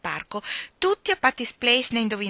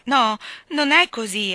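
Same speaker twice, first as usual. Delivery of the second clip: floundering; 3 semitones higher, 7 dB louder than usual. A narrator's reading. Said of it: "annaspò la povera Anna".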